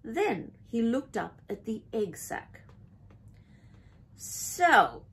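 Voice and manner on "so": loud, drawn, scornful opener; disgusted. A narrator's reading. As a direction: say it with disdain. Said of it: "he said in disgust".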